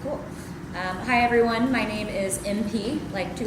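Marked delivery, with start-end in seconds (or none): none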